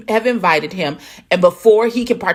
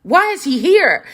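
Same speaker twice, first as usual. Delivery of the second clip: fluster at the uninvited guest; upset Brandon; 9 semitones higher than usual. Retold or imitated. Brandon demanding why Carl came, high and flustered. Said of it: "And like".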